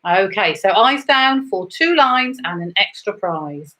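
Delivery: usual speaking voice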